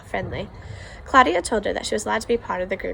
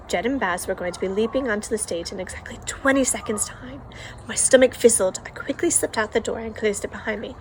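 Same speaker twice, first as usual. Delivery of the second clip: ordinary delivery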